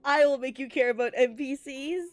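ordinary delivery

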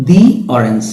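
"the orange" with a long, stressed "dee"; "thee" before vowel. Shown as a demonstration. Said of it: "অরেঞ্জ অ উচ্চারণটা হচ্ছে".

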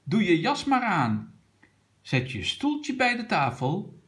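calling out. A narrator's reading. Teacher tells the children to get ready, calling out. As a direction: call instructions out to the class. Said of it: "En juf riep".

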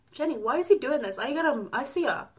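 ordinary delivery

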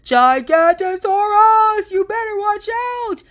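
long, high-pitched acted shout. A narrator's reading. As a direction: bellow the monster alarm at a high pitch. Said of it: "they heard a shout".

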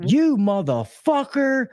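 high, drawn-out mock cursing; wife coming in hot. Wife confronts cheating husband, high drawn-out mock fury. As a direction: imitate a furious wife, slow and high. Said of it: "she came in hot".

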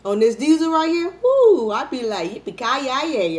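loud, high caffeine-fuelled whoop; hyped up. Real, not acted. Vlogger buzzing on strong coffee, loud excited whoop. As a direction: ride the coffee buzz into a whoop. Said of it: "I talk fast normally".

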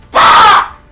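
one very loud, high burst; sudden furious outburst; explosive swearing. Real, not acted. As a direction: scream it in real rage. Player killed in game.

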